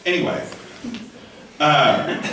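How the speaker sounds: ordinary talk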